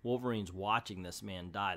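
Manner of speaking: normal talking voice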